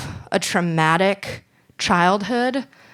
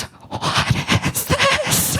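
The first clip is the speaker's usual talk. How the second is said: mock horrified whisper, higher and slow; mom voice, whispered; much slower than usual. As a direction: hiss it like a shocked little mom. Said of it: "And she goes".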